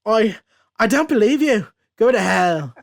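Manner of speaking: put-on defiant prisoner in a comic Irish accent. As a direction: put on a hammed-up Irish brogue. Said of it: "You gotta do that accent".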